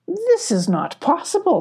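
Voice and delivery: high-pitched amazed disbelief; storybook shock, voice lifted high; disbelieving. A narrator's reading. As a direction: say it to yourself, astonished. Sparkle's disbelief at empty bubble.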